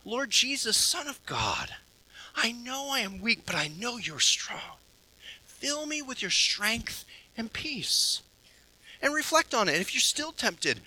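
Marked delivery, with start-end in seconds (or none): 0.0-8.2 quiet prayer. Shown as a demonstration. Preacher models a temptation prayer for the congregation, hushed and tender. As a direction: pray it gently, almost under the breath. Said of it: "a simple prayer of saying".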